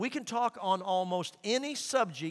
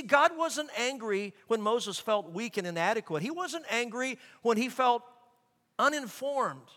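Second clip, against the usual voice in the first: normal talking voice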